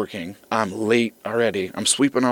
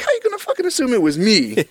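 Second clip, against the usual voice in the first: high-pitched indignant retort; mock offended, pitched up; 11 semitones higher, 4 dB louder than usual. Driver answering the accusing homeowner, indignant.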